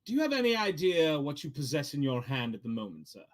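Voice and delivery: mysterious NPC voice. Acted. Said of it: "he turns to you and goes".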